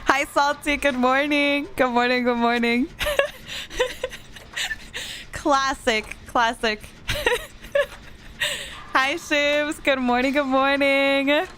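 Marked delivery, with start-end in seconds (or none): 2.9-4.1 high giggle. Real, not acted. Laughs at chat. 7.1-7.9 high giggle. Real, not acted. Laughs at chat.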